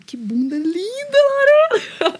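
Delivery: Lorena stretched out very high and slow with a laugh; a mock-adoring mother-in-law drawing out Lorena; mother-in-law gushing. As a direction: gush over her like a doting mother-in-law, cracking up. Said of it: "Toda hora ela falava".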